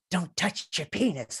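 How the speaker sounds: soft, slow, eerie whisper-voice; inner voice. Retold or imitated. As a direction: speak like a ghostly conscience. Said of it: "hearing weird voices".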